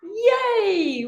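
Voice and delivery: high, sustained "Yay"; celebratory high whoop; group cheer. Shown as a demonstration. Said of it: "and then we say".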